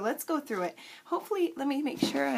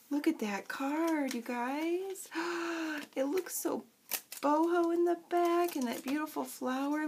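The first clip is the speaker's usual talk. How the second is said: normal talking voice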